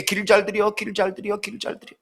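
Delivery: self-reminder fading from murmur to nearly nothing; fading inner mantra. Retold or imitated. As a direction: chant it to himself, dying away. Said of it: "머릿속에".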